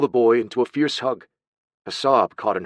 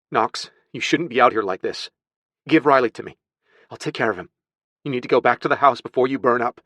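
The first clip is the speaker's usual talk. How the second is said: slightly raised, worried urging; mildly pressing, concerned; urgent concern; 3 semitones higher than usual. Acted. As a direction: press him to get to safety. Urges burning man to go home.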